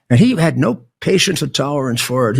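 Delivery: ordinary talk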